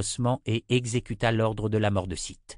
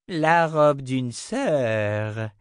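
very slow, raised, gloating answer; wicked smile; 4 semitones higher, 5 dB louder, much slower than usual. A narrator's reading. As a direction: draw it out with a nasty smile. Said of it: "avec un sourire mauvais".

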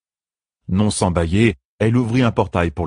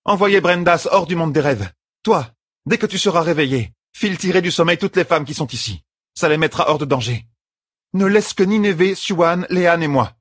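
high-pitched, urgent orders; young woman leader, commanding; 11 semitones higher than usual. A narrator's reading. Young leader sending the sisters to safety, commanding.

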